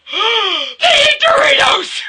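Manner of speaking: drawn-out delighted shriek at the book; shocked fangirl shriek. Retold or imitated. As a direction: scream it like a fan losing it. Retold gasp reaction to book.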